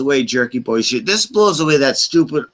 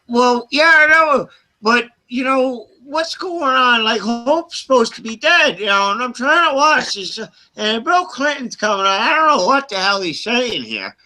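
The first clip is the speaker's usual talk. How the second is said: mock prank caller; 10 semitones higher than usual. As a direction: ham up the prank caller demanding Clinton.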